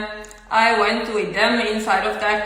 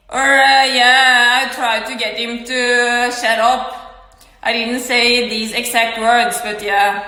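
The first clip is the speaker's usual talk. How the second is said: ordinary talk, nothing marked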